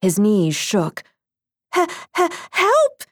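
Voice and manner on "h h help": high, scared stammering cry for help; croaked stammer. A narrator's reading. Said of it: "he croaked".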